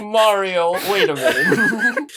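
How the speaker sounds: drawn-out, high Italian Mario impression with a laugh; Italian accent. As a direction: ham up a cartoon Italian plumber. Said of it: "This is sort of like".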